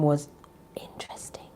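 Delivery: breathy whisper, fading right out on interesting; sudden whisper. Real, not acted. Vlogger teasing viewers about a film, sudden whisper. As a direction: whisper it like a secret.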